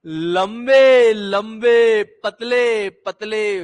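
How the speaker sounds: very slow, stretched-out vowels; cantilena didática. Shown as a demonstration. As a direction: draw each word right out, sliding down at the end. Teacher models a drawn-out chant.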